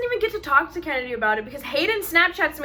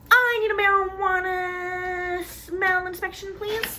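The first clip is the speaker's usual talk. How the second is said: mock official; 7 semitones higher, slower than usual. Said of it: "they're like".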